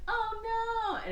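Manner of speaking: high, drawn-out cry of fake horror; mock dismay. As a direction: wail it like a crowd in hammed-up alarm. Everyone's retold dismay at the wreck.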